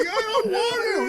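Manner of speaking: high, loud tearful protest through laughter; mock crying. Retelling the reluctant man at the pig kill, mock crying. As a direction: wail it like a comically reluctant pig killer. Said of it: "You don't have to cry so much".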